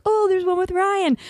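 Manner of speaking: high-pitched mock-delighted "oh"; sing-song squeal of recognition; excited discovery. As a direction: pipe up high, acting out a happy find. Retelling own excited discovery.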